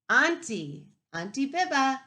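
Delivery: plain reading voice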